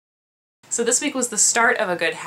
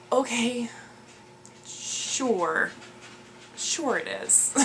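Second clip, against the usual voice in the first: sarcastic okay sure; 4 semitones higher, 8 dB quieter, much slower than usual. Retold or imitated. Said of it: "And I'm like".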